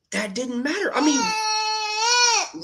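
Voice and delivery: choked up; real tears breaking through; cracking mid-phrase, fighting tears. Real, not acted. Voice breaks, crying.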